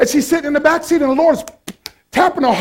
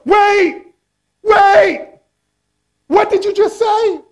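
startled cab driver; 8 semitones higher, slower than usual. Acted. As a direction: call her back in disbelief.

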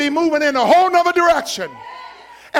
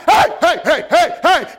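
calling out; 3 semitones higher, 4 dB louder than usual. Acted. Blind man calling out to Jesus.

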